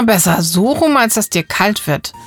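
ordinary delivery

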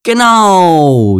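high loud cheer. Acted. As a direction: cheer eagerly like a seal. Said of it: "jubelte Robby".

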